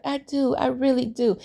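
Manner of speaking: imitating a tearful girlfriend; mock tearful pleading. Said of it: "She over there crying".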